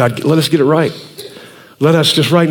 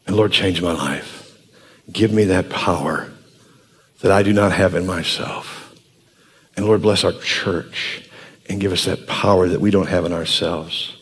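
closing prayer; 12 semitones lower, 8 dB quieter than usual. Acted. Pastor praying over his church, hushed low prayer voice.

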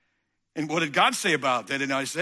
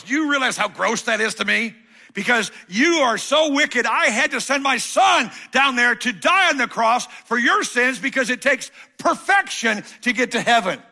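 louder, higher stern rebuke; voice of God; 9 semitones higher, 8 dB louder than usual. Pretend. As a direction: thunder at the sinner as God. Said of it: "And God goes".

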